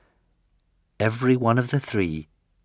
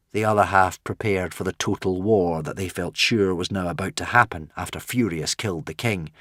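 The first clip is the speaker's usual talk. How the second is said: ordinary delivery, nothing marked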